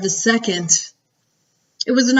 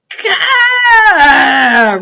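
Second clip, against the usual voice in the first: long, high, loud roar; 9 semitones higher, 9 dB louder than usual. A narrator's reading. Nightmare grips the sleeping giant, roaring. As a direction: roar it out in a drawn-out wail. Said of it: "roared the Flesh Lump Eater".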